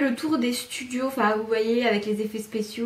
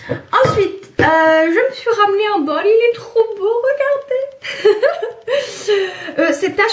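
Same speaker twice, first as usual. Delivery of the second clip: much higher and louder, bubbling with delight; excited gushing; 10 semitones higher, 6 dB louder than usual. Real, not acted. Showing her new bowl to viewers, gushing with giggly excitement. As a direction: squeal over the bowl like a new treasure.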